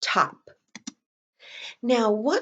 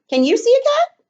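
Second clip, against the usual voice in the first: high and loud with a big rise on "cat"; exaggerated question read with a high, loud rising "cat"; question tune; 6 dB louder than usual. Shown as a demonstration. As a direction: ask it brightly, lifting "cat" high. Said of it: "but maybe a question".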